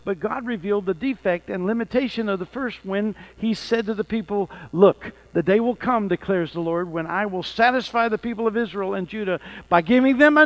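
loud, ringing reading of the Bible; fervent scripture reading. Acted. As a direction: proclaim the verses with fire. Reads scripture with force.

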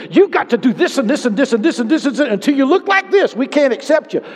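high, loud scolding list with a laugh; mock legalistic preacher. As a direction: lampoon a demanding church. Mocking a harsh legalistic ministry.

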